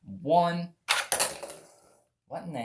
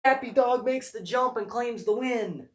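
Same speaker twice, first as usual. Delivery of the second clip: high, loud announcer call; announcer voice gone odd; 11 semitones higher, 5 dB louder than usual. Acted. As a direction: call the win in a high, excited pitch. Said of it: "My announcer voice, but for some reason it changed to this".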